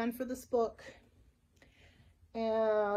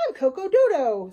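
loud, pushy dodo shopkeeper; loud, brash dodo introducing himself; Coco Dodo; 10 dB louder than usual. A narrator's reading. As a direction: boom out the name proudly. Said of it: "said the dodo".

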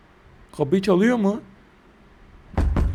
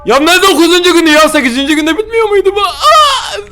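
high, loud laughing disbelief into a shriek; shocked outcry; 12 semitones higher, 6 dB louder than usual. Real, not acted. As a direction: yell in disbelief, then shriek. Surprised by extra day.

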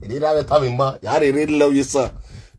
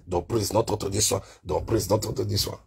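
imitated hushed complaint; 8 semitones lower, 9 dB quieter than usual. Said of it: "any small thing you come corner".

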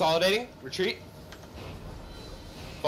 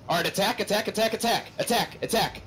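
shouting; 8 semitones higher than usual. Real, not acted. Shot-calling a team fight.

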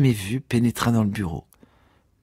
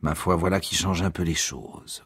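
low, soft condescending concession; quiet, lowered, self-important; grudging concession; 5 semitones lower, 6 dB quieter than usual. A narrator's reading. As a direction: concede it loftily under your voice. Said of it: "concéda Ignatius".

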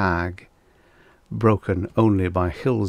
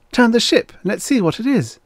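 higher and louder, eager plea; excitedly; 13 semitones higher, 5 dB louder than usual. A narrator's reading. Young woman begging the captain to turn, excited. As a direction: urge the captain on, breathless with eagerness. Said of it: "Nasha said excitedly".

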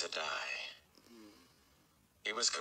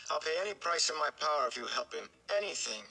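high, fast, desperate blurt; in a rush; 9 semitones higher, faster than usual. A narrator's reading. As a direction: get it all out in one breath. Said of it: "finished up in a rush".

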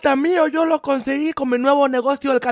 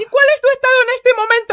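fast, loud, high demand; stern interrogation; 9 semitones higher, 6 dB louder, faster than usual. Acted. Mother quizzing the drunk on their state, angry. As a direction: fire the question at them angrily.

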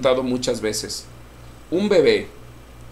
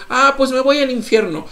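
high, loud flippant mock; mocking careless voice; 11 semitones higher, 6 dB louder than usual. Pastor mimicking a flippant sinner, high and loud. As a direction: throw it away flippantly. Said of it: "si es tan ligero decir".

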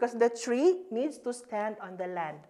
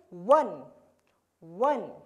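one snapped out short and louder; quick one; 3 semitones lower, 5 dB louder than usual. Shown as a demonstration. Teacher showing students a quick one. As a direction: say one fast and crisp. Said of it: "Make it very quick".

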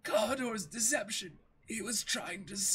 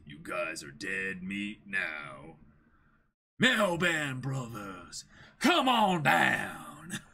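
lower, slow menacing villain; 12 semitones lower, 4 dB louder, much slower than usual. Acted. The pirate villain summons the Meowban brothers, slow and menacing. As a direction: gloat slowly, then call them in.

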